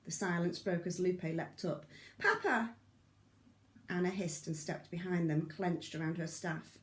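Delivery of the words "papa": high, louder joyful call; excited cry. A narrator's reading. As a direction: cry out to her father. Daughter sees her father.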